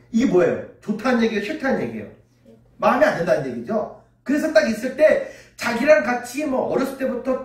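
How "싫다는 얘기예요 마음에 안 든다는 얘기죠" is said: ordinary talk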